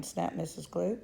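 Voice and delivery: ordinary delivery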